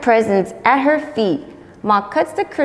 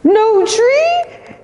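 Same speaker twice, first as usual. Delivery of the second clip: high, loud cow wail; a stricken cry; wail of dismay; 14 semitones higher, 6 dB louder than usual. Acted. Moesha wailing there's no Christmas tree, high and loud.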